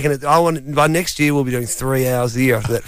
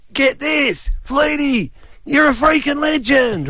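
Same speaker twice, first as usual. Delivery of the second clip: high-pitched imitated yell from a passing car; acted fan's yell, pitched way up; 9 semitones higher, slower than usual. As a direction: holler it like an excited fan driving by. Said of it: "a guy yells out".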